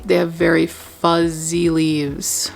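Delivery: usual speaking voice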